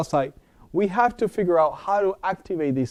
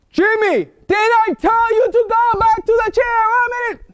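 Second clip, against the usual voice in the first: high, loud angry mom yell; scolding mother; 4 dB louder than usual. Retold or imitated. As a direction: scold Jimmy like a furious mother. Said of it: "So what does Mommy do?".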